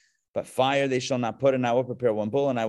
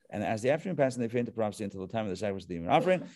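quick, softer run through the verse; rushed rereading; 3 semitones lower, 5 dB quieter, much faster than usual. Real, not acted. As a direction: rattle it off under your voice. Rattles through the same verse again.

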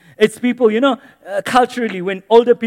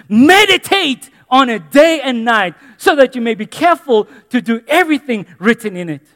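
scripture read with force; 4 semitones higher, 7 dB louder than usual. Acted. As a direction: proclaim the verses to the church.

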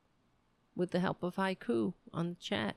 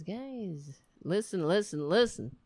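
normal talking voice

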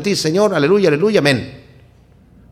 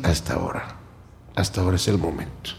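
much softer and lower, calm reply; gentle divine answer; 10 semitones lower, 10 dB quieter, faster than usual. Acted. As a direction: answer softly like God reassuring. Voicing God's gentle answer.

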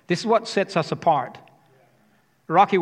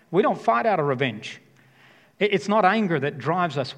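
ordinary delivery

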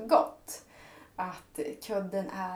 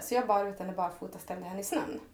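usual speaking voice

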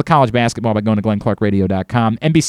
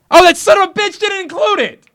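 high, hammed-up squeal of outrage; mock outrage. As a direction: overplay the betrayal. Mock outrage at missing pick.

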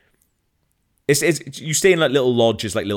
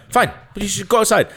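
mock official voice; 11 semitones higher, 3 dB louder, faster than usual. Mocking official rule changes.

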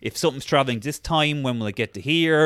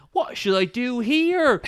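higher and slow, a bit lost; mock helpless woman; 9 semitones higher, slower than usual. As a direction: ask the camera as a clueless office worker, slowly. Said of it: "the woman turns to the camera".

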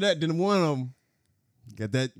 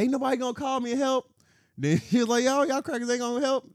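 much higher ranting voice; mock drunk rant; 10 semitones higher than usual. Retelling to a friend, the drunk lady's high mock rant. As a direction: play the drunk lady yelling at everyone.

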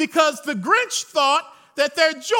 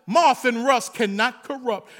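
normal talking voice

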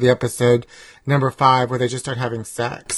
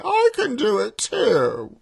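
higher, slow fake posh accent; put-on posh accent; 9 semitones higher, slower than usual. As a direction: drawl it out like a period drama lady. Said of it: "the accent that you're using".

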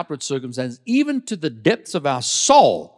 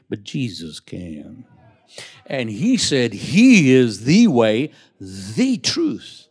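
slow solemn emphasis; 5 semitones lower, 4 dB louder, slower than usual. Acted. Slow solemn preached claim.

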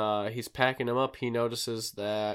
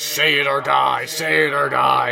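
ghostly surveyor; 3 semitones higher, 10 dB louder than usual. Retold or imitated. Carter's dead victim haunting him, loud ghostly threat. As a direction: boom it out like a vengeful ghost. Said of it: "that's when it starts".

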